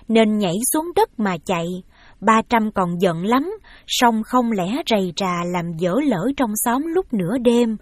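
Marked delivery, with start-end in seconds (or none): none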